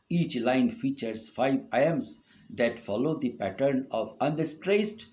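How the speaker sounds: normal talking voice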